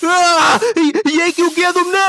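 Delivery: high, loud yelp of shock; hammed-up pained cry. Partner cut with scissors in a class skit, hammed-up pained cry. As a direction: cry out in mock agony. Acted cutting victim cries out.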